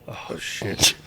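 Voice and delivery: voiceless, hushed dread; dismayed whisper. Retold or imitated. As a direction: whisper it as a sinking realisation. Voicing a rival's alarmed inward thought.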